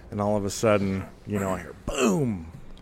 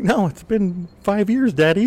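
high, louder, sing-song innocence; innocent whine; 11 semitones higher, 5 dB louder than usual. Retold or imitated. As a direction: play the sweet innocent kid. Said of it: "I'm like".

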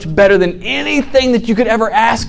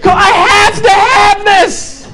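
desperate pleading; 13 semitones higher, slower than usual. Acted. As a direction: beg the buyer to take it all. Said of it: "he's like".

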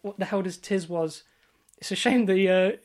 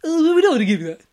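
higher and louder, hammed-up game show taunt; mock taunting host; 10 semitones higher, 10 dB louder, much faster than usual. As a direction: ham up the gloating host. Echoing the taunting host line.

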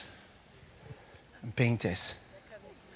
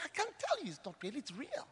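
much softer, solemn answer; hushed reply; 7 semitones higher, 15 dB quieter than usual. Retold or imitated. Kenneth quietly answering his dying auntie.

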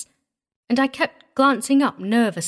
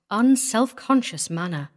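plain reading voice

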